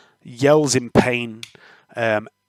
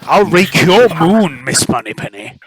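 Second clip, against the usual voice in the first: Sean Connery; 5 dB louder than usual. Acted. Game master parodying a spy film line, Sean Connery accent. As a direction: ham up a slushy Scottish spy voice. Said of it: "Sean Connery accent".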